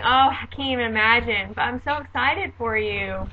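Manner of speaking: upbeat, supportive congratulations. Shown as a demonstration. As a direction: say it brightly, glad for the expectant mother. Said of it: "an upbeat tone saying".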